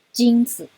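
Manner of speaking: 金子 with stress on 金, 子 short and light; neutral tone demo. Shown as a demonstration. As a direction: say 金子 with 子 quick and unstressed. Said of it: "你的重音是放在金上".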